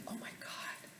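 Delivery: breathy shocked whisper to herself; a horrified inner whisper; whispered thought. Retold or imitated. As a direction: mouth it in a stunned whisper. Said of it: "I was thinking".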